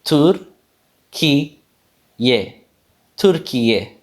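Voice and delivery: Türkiye slowly, three syllables ending in a pure "e"; Turkish pronunciation. Shown as a demonstration. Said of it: "just for the Turkish pronunciation".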